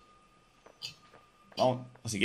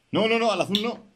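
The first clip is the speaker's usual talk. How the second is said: high-pitched urgent protest; real alarm, pitch leaping right up; alarmed warning; 12 semitones higher than usual. Real, not acted. Player warning his teammate off the blue one, alarmed.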